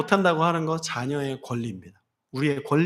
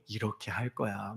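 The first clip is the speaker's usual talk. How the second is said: soft, slow and low, not authoritative; gentle God voice; 7 semitones lower, 11 dB quieter than usual. Acted. 하나님이 모세에게 부드럽게 대답하는 목소리, 낮고 조용하게. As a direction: speak as a kind father would. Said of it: "심판적으로 막 권위 있게 오지 않아요".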